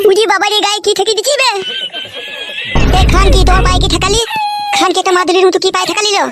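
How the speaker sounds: high, crying panicked shout; panicked wail. Acted. Husband wails at wife over the amulet, panicked crying shout. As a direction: wail in terror that you've been cursed.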